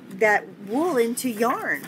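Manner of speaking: ordinary delivery, nothing marked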